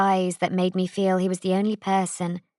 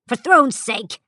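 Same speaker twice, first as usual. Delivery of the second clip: sharp, louder, higher snap; snapped; 4 semitones higher, 5 dB louder, slower than usual. A narrator's reading. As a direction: bite it off in pain and irritation. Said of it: "he snapped".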